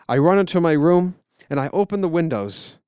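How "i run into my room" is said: ordinary delivery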